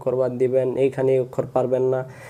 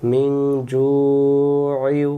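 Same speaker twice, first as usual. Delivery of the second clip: very slow, melodic drawn-out recitation; Quran recitation; much slower than usual. Shown as a demonstration. Teacher modelling the correct words for students, slow chant. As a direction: stretch মিন and জু'ইউ in a long chant. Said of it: "recites word as model".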